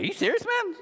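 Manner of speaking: high-pitched disbelieving question; incredulous. Retold or imitated. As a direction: retell it in squeaky mock disbelief. Retold disbelieving reply to a friend.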